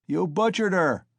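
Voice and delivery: high cry from the crowd; accusing shout. A narrator's reading. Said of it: "someone shouted in the crowd".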